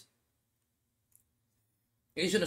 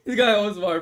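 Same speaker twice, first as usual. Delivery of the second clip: laughing, high and loud; laughing at a fake name; 5 semitones higher, 7 dB louder than usual. Real, not acted. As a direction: laugh through the words. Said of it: "you scared me".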